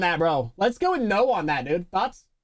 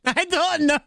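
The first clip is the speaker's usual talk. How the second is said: higher, hammed-up Irish accent; mock Irish; 6 semitones higher than usual. Streamer playing along with the Irish slip, mock Irish. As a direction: push the mock Irish brogue up high. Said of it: "Why are you Irish?".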